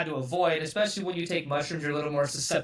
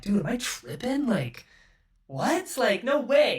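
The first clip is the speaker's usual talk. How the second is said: higher-pitched, incredulous retort; retold disbelief; 6 semitones higher, slower than usual. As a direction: act out your own baffled disbelief, pitched up. Retelling own disbelief at the voice.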